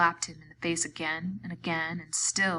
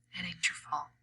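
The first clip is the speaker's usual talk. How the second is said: soft reassurance; 8 dB quieter than usual. A narrator's reading. Slim comforting Lenny.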